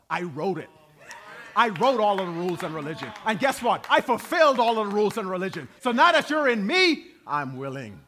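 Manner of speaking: God declaring his authority, swelling; preacher voicing God, building to a shout; God speaking, building. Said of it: "the source says".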